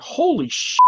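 high, cut-off oath; shocked curse. Retold or imitated. Watching the bear thrown into guardrails, shocked. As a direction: gasp out the curse and stop. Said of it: "And I'm like".

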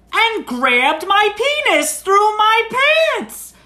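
high, loud and drawn-out retold outrage; mock-yell it slowly, hammering each word; indignant outburst. Friend's outraged account, retold.

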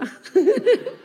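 high-pitched burst of laughter; amused laugh. Real, not acted. Laughs after joking about men.

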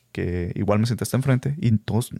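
normal talking voice

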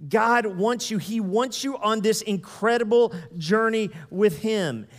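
drawn out, each word weighted; slow, loud emphatic preaching. Acted. Preacher driving home his point to the congregation, slow and loud.

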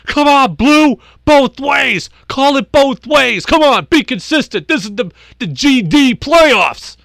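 high, loud furious yell at the umpire; coach yelling at ump. Retold or imitated. Angry coach screams at the umpire to call it both ways. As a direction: bark the complaint like an enraged base coach. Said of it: "he screams at the ump".

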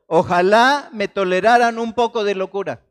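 loud, fed-up appeal to be tolerated; exasperated plea. Retold or imitated. Preacher voicing a fed-up Paul, loud and exasperated. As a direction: throw it out like an annoyed apostle. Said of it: "Así, con ese tono".

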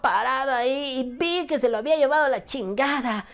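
high, loud cheesy dubbed-series voice; bad TV dub voice. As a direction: play a badly dubbed rescue show actor. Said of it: "parece doblaje como de, de esas series". (voz de doblaje de serie)

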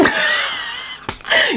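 laughing at own joke; a shrill burst of laughter, high up; high squealing laugh. Real, not acted. Laughing at the idea of calling the police, high squeal.

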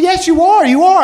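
stubborn insisting. Retold or imitated. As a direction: dig in and refuse to back down. Girlfriend insists again, stronger.

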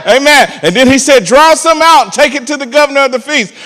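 loud, high-pitched preaching; fiery gospel preacher; preaching at full force. Acted. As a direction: preach it out to the congregation.

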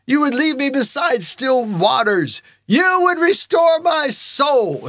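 high, slow, fervent appeal to God; pleading. Acted. Believer reminds God of his promises, impassioned pleading. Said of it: "No, I'm pleading".

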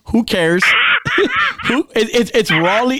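laughing through "Raleigh" after his mix-up; amused, laughing admission. Real, not acted. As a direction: giggle the words out.

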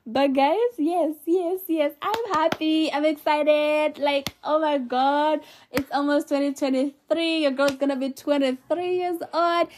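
bubbling over with real excitement; excited. Real, not acted. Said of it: "I'm this excited".

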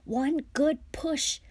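high, slow, effortful urging; panting. A narrator's reading. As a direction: push each word out with strain. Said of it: "panted Fred, who was pulling".

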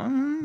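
high, quiet sleepy hum; groggy waking groan. Retold or imitated. Friend woken by a phone call, imitated groggy groan. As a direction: imitate a just-woken mumble of "mmm". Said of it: "寝起きでもうなんか、".

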